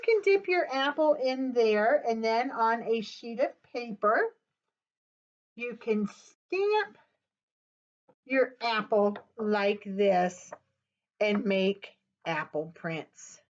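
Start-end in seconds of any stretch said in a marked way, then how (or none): none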